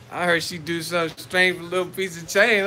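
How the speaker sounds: normal talking voice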